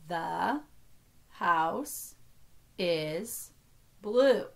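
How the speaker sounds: every word alone, with a pause after it. Shown as a demonstration. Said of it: "only count each word as one".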